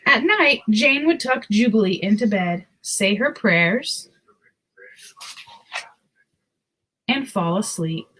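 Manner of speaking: put-on reading a picture book to children; story narration. A narrator's reading. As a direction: tell it gently to little listeners.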